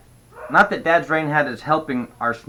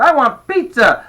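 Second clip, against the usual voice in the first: put-on high, loud kid's demand; shouted demand; 12 semitones higher, 9 dB louder than usual. A narrator's reading. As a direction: shout for what you want. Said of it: "the pain shouted".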